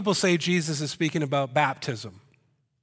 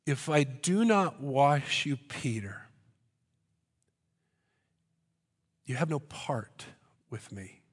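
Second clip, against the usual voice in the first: hushed emphatic repeat; 5 dB quieter than usual. Acted. Repeats with long pauses, fading.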